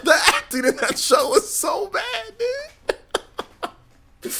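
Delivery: high, cracking voice through laughter; laughing hard. Real, not acted. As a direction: talk while laughing hard.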